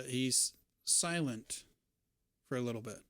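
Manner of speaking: ordinary delivery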